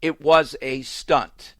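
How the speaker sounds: It was a stunt, said slowly with stunt hit hard; slow, lean hard on the word stunt; stress on stunt. Shown as a demonstration. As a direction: spell it out slowly, punching stunt. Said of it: "Emphasize that again and again and again".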